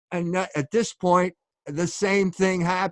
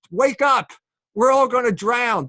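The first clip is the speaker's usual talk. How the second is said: higher, louder panicked cry; panicked apostles; 6 semitones higher, 5 dB louder than usual. Retold or imitated. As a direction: voice the frightened apostles shaking him awake. Apostles wake Jesus in storm.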